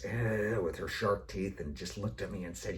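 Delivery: usual speaking voice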